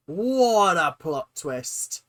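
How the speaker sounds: slow, drawn-out and emphatic. Real, not acted. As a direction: savour each word slowly. Repeated exclamation, slowed.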